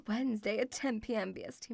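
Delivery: normal talking voice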